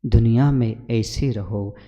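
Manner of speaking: slow, measured chanting of verse, fading at the end; verse recitation. Acted. Guru reminds devotees of a couplet, slow recitation. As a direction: recite it slowly, letting it trail into softness.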